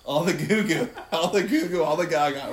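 put-on loud sing-song baby talk. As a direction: coo at a baby, loud and laughing. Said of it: "how would you talk to them?".

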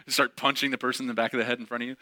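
ordinary delivery

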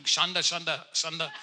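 playful, laughing chant of a name; mock speaking in tongues. Preacher jokes on the wife's name, mock tongues. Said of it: "Sounds like a tongue".

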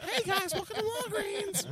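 very high falsetto welcome; sing-song it like a perky clerk; sweet cashier greeting. Retold or imitated.

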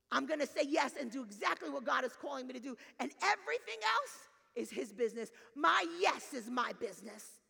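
loud, ringing declaration; impassioned preaching. Acted. Preacher models congregation's answer to God, impassioned. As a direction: proclaim it with full pulpit force.

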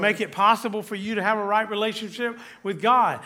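high, loud, impassioned sermon climax; full-force preaching. Acted. Pastor exults over God's welcome to sinners, impassioned and loud. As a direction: lift the room with God's welcome.

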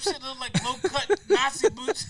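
cracking up while talking; words breaking into laughter; laughing. Real, not acted. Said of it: "I'm fucking in tears".

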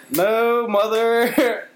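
high, prissy denial to mom; mock prim reply. Son's own retold denial to mom, high and mock-proper. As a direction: answer mother in an exaggerated proper voice. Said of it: "And I was like".

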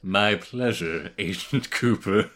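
slow, theatrical character voice; put-on drawn-out formal politeness; imitated Doctor Colonel Junior. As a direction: savor each word with creepy courtesy. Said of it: "he's like".

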